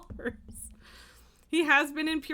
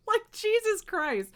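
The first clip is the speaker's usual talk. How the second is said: high, slow, disgusted exclamation; fed-up and incredulous; angry exasperation; 11 semitones higher, 4 dB louder, slower than usual. Real, not acted. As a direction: draw it out in sheer disbelief. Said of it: "That makes me so angry".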